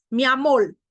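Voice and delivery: loud "amor" ending in L, amol; lambdacism. Shown as a demonstration. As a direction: swap final r for l in "amor". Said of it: "en vez de la R, va la L".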